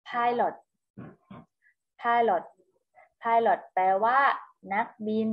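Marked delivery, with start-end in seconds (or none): 0.1-0.5 "pilot" said alone as "PIE-lot", falling on the second syllable; English word model. Shown as a demonstration. Teacher gives children the English for นักบิน, "pilot" modelled with a falling tone. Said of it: "ภาษาอังกฤษอ่านว่า". 2.0-2.4 "pilot" repeated as "PIE-lot", falling on the second syllable; English word model. Shown as a demonstration. Teacher repeats the English for children, "pilot" modelled as a clear example. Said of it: "ภาษาอังกฤษอ่านว่า".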